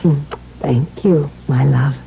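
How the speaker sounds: ordinary talk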